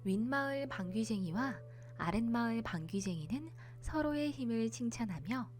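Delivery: plain reading voice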